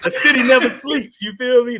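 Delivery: loud, high mock city hustler; pushy Northerner. Imitating a Northerner rushing an investor, loud and pushy.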